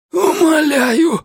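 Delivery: high voice cracking as he screams; breaking cry. Acted. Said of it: "срывающимся голосом закричал он".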